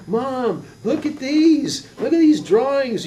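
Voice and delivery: put-on high, loud, drawn-out kid calling Mom; high-pitched wonder, stretching the words; excited little kid. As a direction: call out like an amazed five-year-old. Five-year-old calling mom in story.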